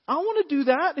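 high, loud burst of eagerness; eager outburst. Acted. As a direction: blurt it like a keen child wanting in. Acts Peter begging to join.

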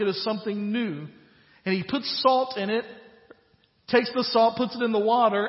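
loud, high-pitched full-force preaching. Acted. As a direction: preach it out to the whole congregation at full voice.

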